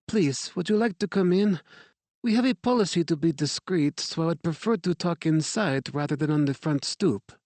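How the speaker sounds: a gracious French director's greeting; French accent. A narrator's reading. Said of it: "her English marked with a French accent".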